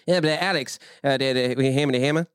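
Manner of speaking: stumbling, mumbled Oklahoma request; middle-of-Oklahoma accent. Retold or imitated. Imitating an Oklahoma man asking Alex, garbled accent. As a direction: slur the request in rural Oklahoma. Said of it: "Like, he'd be like".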